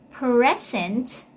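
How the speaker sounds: "Present" with a strong PRE; "Present" as in a gift, PREZ-ent; stress on first syllable. Shown as a demonstration. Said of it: "take note of the stress".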